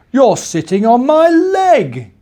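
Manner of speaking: slow booming giant complaint. Acted. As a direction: rumble it like a startled giant.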